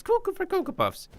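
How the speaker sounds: very high, loud squeaky cartoon voice; squeaky cereal mascot. Streamer riffing on the bird name in a high cartoon squeal.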